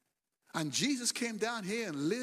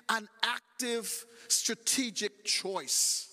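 slow emphasis; much slower than usual. Acted. Preacher stresses key point.

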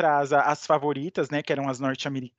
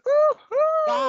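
excited cheer; 3 dB louder than usual. Real, not acted. Cheering Brazil's medal count.

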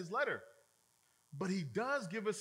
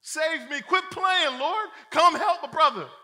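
high, loud, desperate yelling; panicked plea; 10 semitones higher, 15 dB louder, slower than usual. Retold or imitated. Pastor voices himself sinking, yelling for help. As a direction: beg like you're going under. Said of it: "I'm like".

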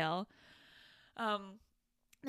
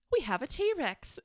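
higher put-on movie voice; John Hammond; 5 semitones higher, 3 dB louder than usual. Podcaster as Hammond revealing the dinosaur, higher put-on voice. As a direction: deliver it as a proud reveal in imitation. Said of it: "And he just goes".

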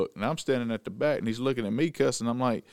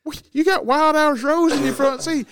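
loud, higher, mocking retort; mock exasperated quote; 7 dB louder than usual. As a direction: call him out loud, half-laughing. Mocking Grizzly to his face.

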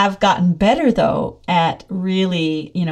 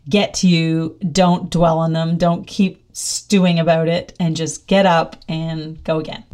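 normal talking voice

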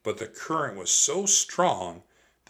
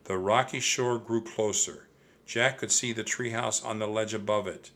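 ordinary delivery, nothing marked

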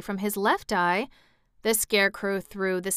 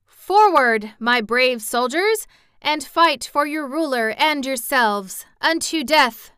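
higher, louder battle call; rallying cry; 5 semitones higher, 6 dB louder than usual. A narrator's reading. Ozma ordering her army forward, loud rallying cry. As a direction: rouse the troops to charge.

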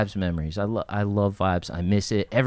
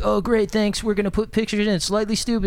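higher, chirpy mock voice; clueless interviewer; 10 semitones higher than usual. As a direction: play the oblivious reporter. Imitates clueless magazine interviewers.

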